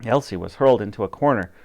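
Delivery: plain reading voice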